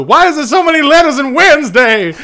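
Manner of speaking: high, loud exasperated outcry; quoting a TikTok. Retold or imitated. Quoting a favorite TikTok about Wednesday, high exasperated imitation. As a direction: imitate an exasperated viral rant. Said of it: "my favorite TikTok of all time".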